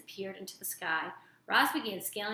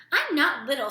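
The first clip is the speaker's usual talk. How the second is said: snapping; 5 semitones higher, 8 dB louder than usual. A narrator's reading. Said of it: "snapped Thorn under his breath".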